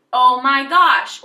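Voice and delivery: loud, drawn-out gasp of amazement; slow, loud "oh my gosh" at the amazing sight; astonished. Shown as a demonstration. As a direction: blurt it out in shock. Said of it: "be like".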